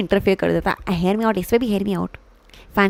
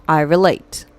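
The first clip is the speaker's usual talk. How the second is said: ordinary talk, nothing marked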